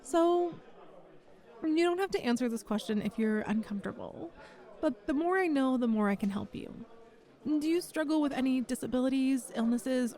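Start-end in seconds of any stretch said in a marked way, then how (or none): none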